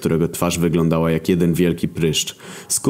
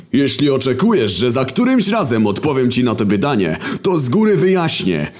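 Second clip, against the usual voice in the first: much higher, half-sung sarcastic rant; War's sing-song rant; 10 semitones higher than usual. Acted. War answering Death, high sing-song mocking rant. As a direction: sing out the swearing like a mocking chant. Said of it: "odpowiedział spokojnie Wojna".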